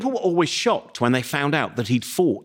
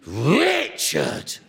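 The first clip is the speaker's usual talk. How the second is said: higher, very slow put-on outrage; mock furious old lady; 8 semitones higher, much slower than usual. [name] complains to the librarian about the disc, in mock fury. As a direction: drag out filthy in hammed-up indignation. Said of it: "She is furious".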